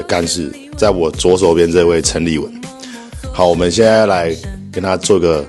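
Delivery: upbeat show host intro; morning radio dj. Acted. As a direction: announce the guest like a radio host. Opens show as host over music.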